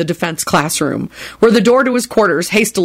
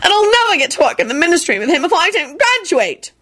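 higher, miserable cry; mild mock shriek of misery; unhappy shriek; 11 semitones higher than usual. A narrator's reading. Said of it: "Dorcas shrieked unhappily".

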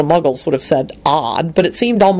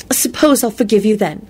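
prim; 4 semitones higher than usual. A narrator's reading. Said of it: "she said primly".

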